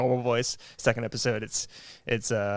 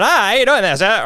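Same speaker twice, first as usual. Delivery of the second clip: much higher and louder radio announcer voice; old-timey radio voice; 9 semitones higher, 8 dB louder than usual. Pretend. Host mimicking early twentieth century radio talk, much higher and louder. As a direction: boom it like a 1920s radio host. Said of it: "were people really walking around going".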